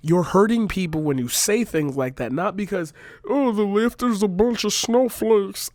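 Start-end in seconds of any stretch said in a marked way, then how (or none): 3.3-5.7 slow, higher mock voice for the other side; mocking silly voice. Mocking critics online in a slow silly put-on voice. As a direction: sneer it out in a put-on caricature voice. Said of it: "I don't even know what voice that was".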